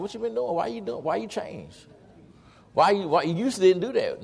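high, insistent run of questions; mock nosy questioning. Imitating people puzzled by his change, mock nosy questioning.

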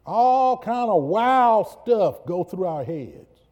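high, loud, slow preaching cry; impassioned preaching. Acted. Preacher to congregation, slow, loud and high. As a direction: drive it home to the church.

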